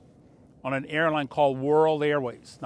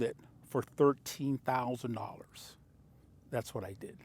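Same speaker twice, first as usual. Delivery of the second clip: whispered reveal; 3 semitones lower, 9 dB quieter than usual. Real, not acted. Reveals big profit on the car.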